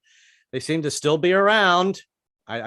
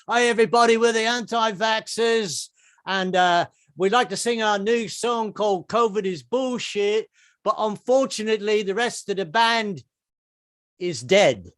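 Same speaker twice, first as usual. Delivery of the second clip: punk band frontman; 6 semitones higher than usual. Retold or imitated. Punk band frontman addresses crowd.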